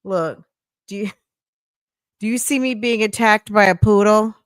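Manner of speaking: ordinary talk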